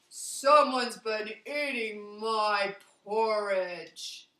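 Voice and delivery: big slow loud bear voice; slow Papa Bear. Acted.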